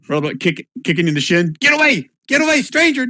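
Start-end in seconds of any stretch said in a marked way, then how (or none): 1.6-3.1 put-on high-pitched kid's yelling; screaming little boy. Game master as boy kicking the stranger, screaming. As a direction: yell it high, as a kid would. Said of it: "They're all screaming".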